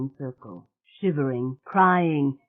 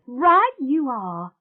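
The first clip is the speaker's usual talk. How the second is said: much higher and louder, slow and elated; delighted exclaim; 10 semitones higher, 6 dB louder, much slower than usual. Acted. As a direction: burst out with joy at the idea. You genius.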